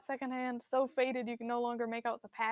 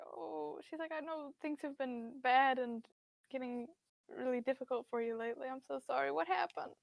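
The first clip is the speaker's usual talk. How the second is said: frail sympathetic mother. Acted. Mother voice high soft.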